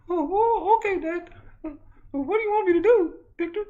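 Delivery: very high nervous-patient voice; high-pitched son character; squeaky son. Acted. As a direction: squeak it out timidly. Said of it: "you can talk normal now".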